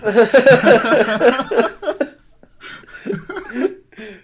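big high burst of laughter; loud laughing fit. Real, not acted.

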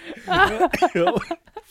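giggling burst; high laugh. Real, not acted. Friends joking.

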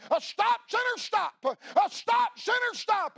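high, loud hollered warning; bellowing revival preacher crying stop; hollering preacher. Retold or imitated. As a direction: yell it like swinging a lantern in the dark. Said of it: "he hollered in that voice that only he had".

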